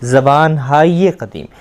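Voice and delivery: ज़बान हाय कदीम said slowly as zabaan haaye qadeem; slow izafat demo. Shown as a demonstration. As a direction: lean on हाय with a light ye glide. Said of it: "वो क्या बनेगी?".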